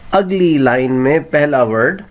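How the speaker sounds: ordinary talk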